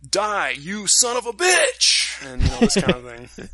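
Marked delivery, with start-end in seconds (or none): 0.1-2.0 slow, higher, hammed-up battle cry; mock English dub wrestler snarl; hammed-up wrestler yell. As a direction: ham it up like a dubbed wrestler clip. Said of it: "they're like".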